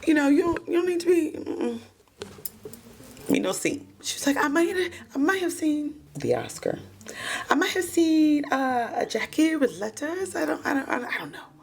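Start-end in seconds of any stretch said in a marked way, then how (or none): 0.1-1.8 nervous witness voice. Retold or imitated. Voicing Juliana's nervous answer. 4.4-11.5 high, halting nervous witness voice; high stammering witness. Retold or imitated. Juliana stammering to the cops, high nervous voice.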